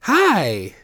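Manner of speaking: imitating mom's nice phone voice; fake phone voice. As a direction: chirp it like mom picking up the phone. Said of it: "she talks in a higher pitched voice".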